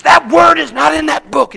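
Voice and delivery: shouted high and loud, furious; angry scream. Retold or imitated. Raging inmate screams at the preacher. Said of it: "he's screaming at me".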